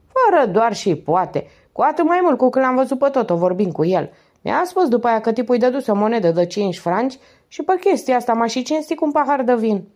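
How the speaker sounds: a lightly voiced tipsy market woman; fat Nana, tipsy market woman. A narrator's reading. Nana testifies. (femeie grasă, cherchelită, cu glas răgușit)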